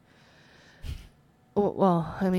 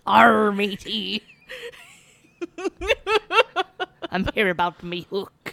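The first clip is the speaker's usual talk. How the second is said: pirate voice; 3 semitones higher, 6 dB louder than usual. Pretend. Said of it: "Why do you sound like a pirate?".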